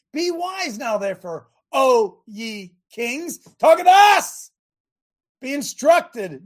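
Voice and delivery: preaching scripture. Acted. Pastor proclaims Psalm two to the congregation, preaching. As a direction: proclaim it like the pulpit's on fire.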